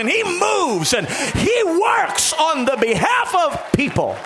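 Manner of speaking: loud, high, shouted preaching over the music; preaching at full force. Acted. Preacher to the congregation, building to a shouting climax. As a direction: lift the whole room, shouting praise.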